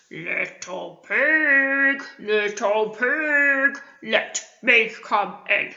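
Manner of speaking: slow, drawn-out big bad wolf. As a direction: coax like a sly wolf at the door. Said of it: "Can you find a wolf voice like me?".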